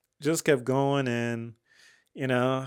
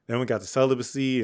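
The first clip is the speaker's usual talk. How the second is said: ordinary delivery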